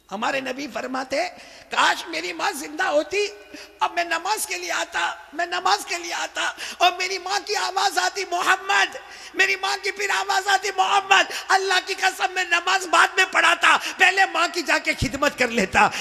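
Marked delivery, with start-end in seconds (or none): 1.7-8.2 high impassioned lament. Acted. Prophet imagining mother calling. 8.3-9.0 mother calling. Acted. 9.3-10.7 high impassioned lament. Acted. Prophet imagining mother calling. 10.8-11.3 mother calling. Acted. 11.5-15.9 high impassioned lament. Acted. Prophet imagining mother calling.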